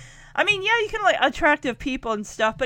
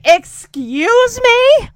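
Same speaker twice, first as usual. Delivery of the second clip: much higher, louder and slow indignant retort; offended Betty; 9 semitones higher, 8 dB louder, much slower than usual. Retold or imitated. Betty confronting the rude contestant, loud and indignant. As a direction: draw it out like she can't believe it.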